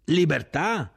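high, loud one-word query; shouted question. A narrator's reading. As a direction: yell it back as a question. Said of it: "gridò l'ufficiale".

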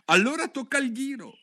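high, loud call; shouting together. Acted. Hatter and hare shouting at the dormouse, loud. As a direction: cry it out together. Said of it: "urlarono insieme".

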